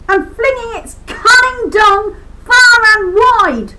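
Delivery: high, loud shouting outburst; ranting shout, half sung at the end; furious shout. Real, not acted. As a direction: yell it out in fury. Furious climax of rant.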